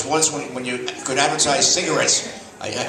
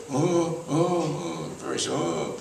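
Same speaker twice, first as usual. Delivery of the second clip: mock frail sighs; 5 semitones higher, 4 dB quieter, slower than usual. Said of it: "She goes".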